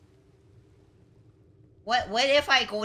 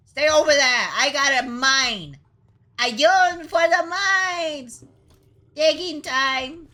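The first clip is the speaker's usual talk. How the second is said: loud, high, drawn-out holler; gleeful cartoon shouting; mining battle cry; 4 semitones higher, 6 dB louder than usual. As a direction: belt it out like a rallying call. Calling out while mining.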